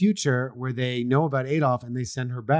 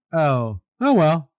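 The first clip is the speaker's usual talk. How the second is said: indifferent shrug; 4 semitones higher, 6 dB louder, slower than usual. Retold or imitated. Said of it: "he looked like".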